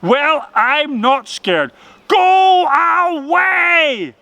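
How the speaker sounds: high, loud, drawn-out rabbit shout; shout at the top of his voice. A narrator's reading. Rabbit shouting at the ghostly storm, high and loud. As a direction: yell defiance at the storm. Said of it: "he shouted at the top of his rabbity voice".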